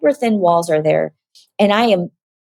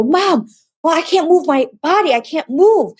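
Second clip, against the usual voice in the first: high-pitched retold panic; mock panic; 9 semitones higher than usual. Daughter telling her mom she is stuck, panicked. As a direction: plead in a high voice as if unable to move.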